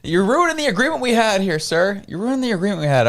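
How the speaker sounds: high, put-on voice scolding him in jest; mock scolding.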